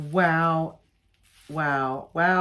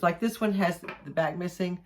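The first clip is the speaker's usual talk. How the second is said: ordinary delivery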